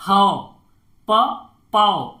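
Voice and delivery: पौ drawn out slowly; slow vowel demo. Shown as a demonstration. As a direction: stretch the औ in पौ. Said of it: "औ का sound आना चाहिए". (पौ धीरे खींचकर)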